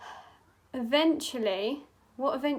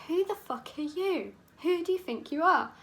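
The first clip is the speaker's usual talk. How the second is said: raised-pitch, affronted retort; indignant challenge; 6 semitones higher, 4 dB louder than usual. Acted. Possessed Richard to priests splashing holy water, indignant challenge. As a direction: challenge them as if outraged by their nerve.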